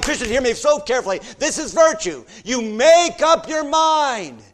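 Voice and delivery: loud, high, impassioned pulpit exhortation; full-force preaching. Acted. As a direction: thunder each line at the congregation.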